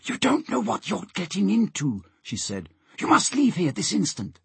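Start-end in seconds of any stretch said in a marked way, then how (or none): 0.1-2.0 put-on high, hushed girl's voice; urgent warning. A narrator's reading. Molly warns Alf and Peter off, urgent hushed voice. 3.0-4.4 high, hushed command; urgent order. A narrator's reading. Molly orders Alf and Peter out, urgent high command.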